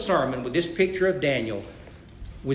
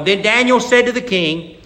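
louder, raised scripture reading; 4 semitones higher, 6 dB louder than usual. Acted. Pastor reading Daniel to the church, raised reading. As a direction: read the verses out with weight.

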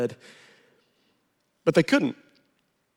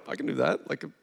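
whispered, much softer, playful; hushed mischievous child thinking; toddler's inner voice; 6 dB quieter than usual. Retold or imitated. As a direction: whisper it like a sneaky kid's thought. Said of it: "he's probably like".

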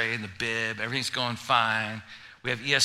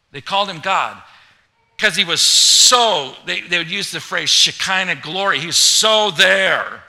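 loud, raised-pitch preaching; preaching at full force; 5 semitones higher, 9 dB louder than usual. Acted. Pastor building up to congregation, full-force loud preaching. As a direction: preach it to the back row.

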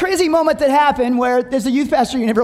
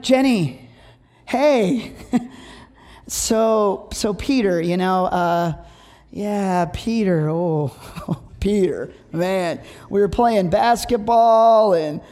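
halting, low, dragging call full of pauses; hesitant bad-news call; 4 semitones lower, much slower than usual. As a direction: stall like you're afraid to tell the mother. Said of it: "this long dramatic pause".

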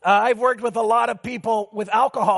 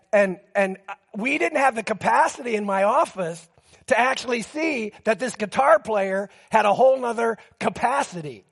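ordinary talk, nothing marked

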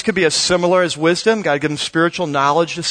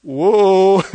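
awed whoa; 5 dB louder than usual. Retold or imitated. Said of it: "He's like".

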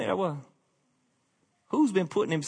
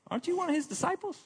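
much higher, fast, pointed question; accusing woman; 4 dB quieter, faster than usual. Acted. Gate woman confronts Peter, high and fast accusing. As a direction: jab the question right at him.

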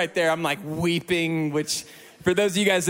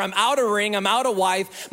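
normal talking voice

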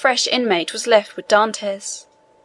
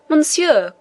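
higher-pitched, understated exclamation; crying out; 7 semitones higher than usual. A narrator's reading. Prisoner appealing to the inspector, crying out. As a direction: call out with mild urgency, pitched up. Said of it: "cried Dantès".

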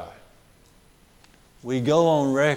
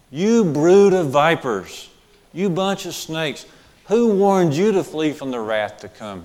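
fiery rebuke; 4 semitones higher, 7 dB louder than usual. Retold or imitated. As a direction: hurl it at the crowd. John denouncing Pharisees, repeated.

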